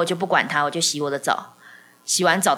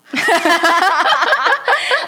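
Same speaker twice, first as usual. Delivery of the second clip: loud high laugh; 11 semitones higher, 7 dB louder than usual. Real, not acted. Laughing at Peppa Pig joke.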